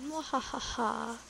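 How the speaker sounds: slow villain's cackle; a slow, drawn-out mwahaha; evil laugh. Acted. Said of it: "Evil laughed".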